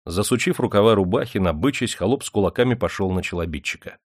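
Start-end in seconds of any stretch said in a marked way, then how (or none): none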